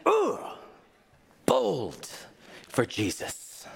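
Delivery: breathy, mocking "ooh, bold for Jesus"; a teasing mock cheer; sarcastic mock praise. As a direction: sneer at the showy post, trailing off. Mocking a bold Facebook post.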